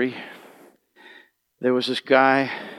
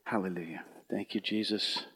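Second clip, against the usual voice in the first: whispered; 4 semitones lower, 11 dB quieter than usual. Real, not acted. Quiet worship moment.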